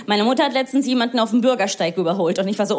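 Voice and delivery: normal talking voice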